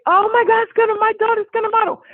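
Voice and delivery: high, loud, fast thrilled mom; overjoyed loud mom. Retold or imitated. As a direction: burst out with joy. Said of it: "She got so loud".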